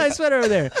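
high-pitched passing compliment; pint-sized glad-hander; mock party schmoozing. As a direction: toss it across the room playfully. Kid complimenting party guests.

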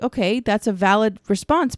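ordinary delivery

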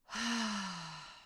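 drawn-out exhaling sigh; long sigh. Real, not acted. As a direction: let out a long breath after an exhausting story. Winding down an emotional story.

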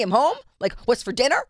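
high, loud mock-casual husband; mocking sing-out, as if nothing had happened; home-from-work husband. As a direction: parody him strolling in expecting dinner. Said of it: "like he's just home from work".

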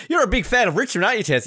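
high, bright mock interviewer; imitating the awkward interviewer. Mocking the interviewer who asks Tessa about Richmond. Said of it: "it's like".

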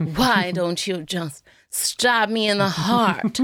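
lightly voiced snooty aunt; Christine Baranski impression. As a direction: play the bored rich aunt, slowly. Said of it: "I've gotta get into her character".

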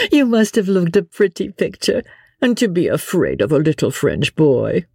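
high, laughing tease; merry laughter. A narrator's reading. Wife picturing her husband afraid, merrily amused. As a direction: tease him merrily, pitched well up. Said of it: "with a long and merry laugh".

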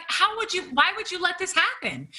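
high-pitched, accusing reenactment; indignant, high-voiced retold complaint; retold mad outburst. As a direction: replay blaming your husband, voice shooting way up. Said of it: "I was mad".

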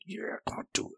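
high, breathy urging whisper; whispered cheer. Retold or imitated. Cheering on the hero in his seat, whispered. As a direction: whisper it like willing him on. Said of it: "I was like".

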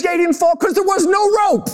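very high imitated outburst; exasperated. Wife setting her husband straight, acted high exasperation. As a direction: deliver the retort at a high, exasperated pitch. Wife's exasperated punchline, retold.

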